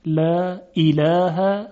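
لا اله with light fronted long vowels; both lams delicate in لا اله; thin lam. Shown as a demonstration. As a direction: say لا اله as "læː ilæːhæ".

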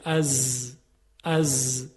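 a low, buzzing «از» like a bee. Shown as a demonstration. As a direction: let «از» buzz like a bee. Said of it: "صدایی مثل صدای زنبور". («از» با صدای وزوز مثل زنبور)

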